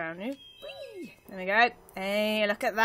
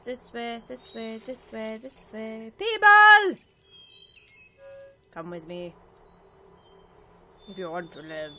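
a hint of a rescuer calling out; terrible action-hero impression; 3 semitones lower, 3 dB louder than usual. Player pretending to rescue game people, lightly voiced action hero. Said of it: "that was a terrible impression".

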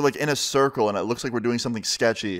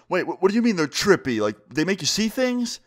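higher-pitched, stammering confusion; dawning alarm; 7 semitones higher than usual. Retold or imitated. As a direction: question it with rising worry. Jim realizes what he ate.

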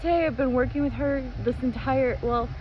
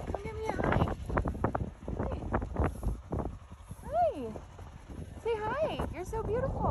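cooing to a horse; 10 semitones higher, 8 dB quieter than usual. Real, not acted. Coaxing the horse to greet.

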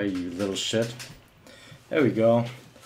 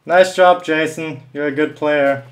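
loud, raised mock congratulations; sarcastic praise; 4 semitones higher, 8 dB louder than usual. Real, not acted. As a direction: praise the camper loudly and insincerely. Sarcastic praise of camper who killed him.

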